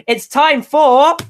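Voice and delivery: high, loud and slow, drawn-out fanfare; announcer build-up. Acted. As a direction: stretch it out like a show announcer. Build-up to announcing new segment.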